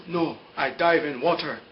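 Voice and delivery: slow, halting character quote; imitated halting English. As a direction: play him picking out each word slowly. Acts out Jin's simple English.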